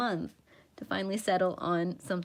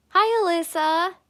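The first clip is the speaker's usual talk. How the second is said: loud, high, slow hello; excited greeting; 10 semitones higher, 10 dB louder than usual. Real, not acted. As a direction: call out brightly to a friend. Greeting viewer in chat.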